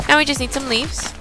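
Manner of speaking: normal talking voice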